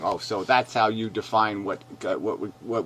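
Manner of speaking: ordinary talk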